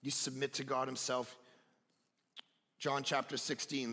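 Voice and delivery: usual speaking voice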